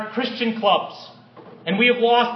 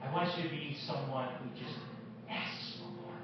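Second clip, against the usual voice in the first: softer, dropping into a whisper; hushed appeal; 7 semitones lower, 16 dB quieter than usual. Real, not acted. Preacher quietly urging the congregation, whispering. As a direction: lean in and breathe the last words.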